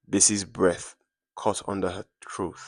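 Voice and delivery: usual speaking voice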